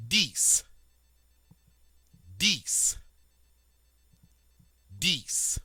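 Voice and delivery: dix said as dees, X like an S; French dix. Shown as a demonstration. Teacher modeling dix for students, clear French. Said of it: "on dit".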